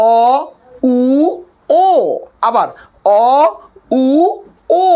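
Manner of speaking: each vowel অ, উ, ও said apart with rounded lips; vowel drill. Shown as a demonstration. Teacher leading the class through অ, উ, ও with rounded lips. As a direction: sound out অ, উ, ও one at a time. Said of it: "সকলে একটু উচ্চারণ করেন".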